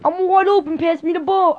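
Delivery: loud, high mock Australian accent. Son playing teammate wanting a pass, Australian shout. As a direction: call for the ball in put-on Australian.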